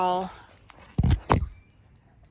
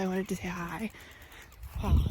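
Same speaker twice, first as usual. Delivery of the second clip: ordinary talk, nothing marked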